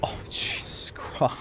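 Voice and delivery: slow breathy whisper. Real, not acted. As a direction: breathe it under your breath. Gasp under breath.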